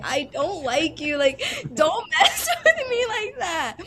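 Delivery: half-laughing, half-crying complaint; giggling mock outrage at a prank; laughing protest. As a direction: scold her while cracking up. Reacting to mom's cruel prank.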